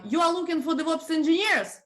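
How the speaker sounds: "You are looking for DevOps engineers?" with the pitch pushed up high; wrong word order, question carried only by a high rising tune; rising statement question. Shown as a demonstration. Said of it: "And intonation, whoo".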